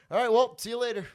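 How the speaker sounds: high and loud cheery farewell; awkward goodbye. Retold or imitated. Comic escaping the chat with a lady, high loud goodbye. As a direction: bail out with a bright yelled goodbye. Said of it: "I'm like".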